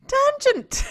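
high, loud cry of the word; loud exclaim. Shown as a demonstration. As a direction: shout the word out. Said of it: "Oh, tangent".

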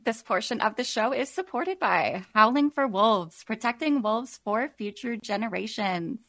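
ordinary talk

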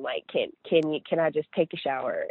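usual speaking voice

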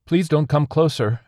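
high, loud warning plea; entity calling out. A narrator's reading. Wounded alien warns off the survivor, calling out. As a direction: plead loudly to keep him back. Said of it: "the entity called out".

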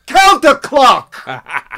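slow, loud high New York shout; New York accent. Retold or imitated. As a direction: yell it long like an old New York director. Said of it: "in his New York accent".

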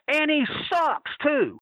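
high, slow, drawn out into the mic; a mocking, stretched-out he sucks too; mock mic taunt. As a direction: taunt it over the PA. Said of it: "I grabbed the mic and I went".

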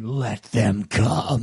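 low, very slow mastermind voice; brooding commander behind mirrored glasses, slow and deep; ominous director. Acted. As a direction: draw it out low, welcoming the challenge. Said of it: "he's just there going".

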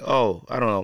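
normal talking voice

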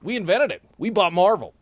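high, loud Texan boasting; Texas accent. Acted. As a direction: brag like a Texas tycoon. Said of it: "moved to Texas and got accents".

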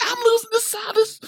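high, indignant disbelief; mock outrage, voice climbing high; incredulous outburst. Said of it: "I said".

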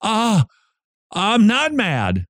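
high, drawn-out protest; retold taken-aback denial of anger; surprised denial. Host answering a relative's accusation, surprised denial. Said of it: "Why are you so mad?".

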